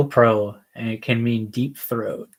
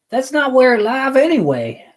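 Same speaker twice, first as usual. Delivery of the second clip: goofy high voice; 12 semitones higher, 5 dB louder than usual. Pretend. Silly voiced punchline.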